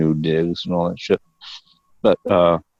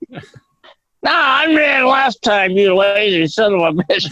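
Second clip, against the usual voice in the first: high, slow, whiny roommate refusing to get up; hammed-up, much higher whine of a lazy roommate; mock lazy roommate; 14 semitones higher, 3 dB louder, slower than usual. Roommates arguing over beer runs.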